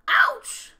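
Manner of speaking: loud, high yelp; howl of pain. Acted. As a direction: howl as if bumping into a tree. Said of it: "howled Mister Winky".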